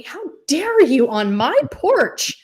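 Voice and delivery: loud, high, drawn-out indignation; mock-indignant protest, punching the words; offended outburst. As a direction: act out slow, emphatic outrage. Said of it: "I was so offended".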